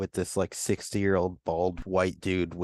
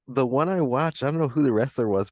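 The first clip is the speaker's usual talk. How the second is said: ordinary talk, nothing marked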